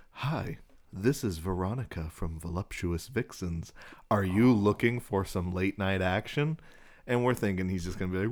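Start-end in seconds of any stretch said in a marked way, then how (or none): 0.1-6.6 imitation text-to-speech voice, measured and singsong; robotic female voice. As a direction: mimic a slow computer-generated woman's voice. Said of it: "that really weird robotic female".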